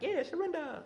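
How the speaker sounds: high-pitched mock-zealous cheer; playfully overdone enthusiasm; mock fervor. Mimicking worshippers' eager surrender.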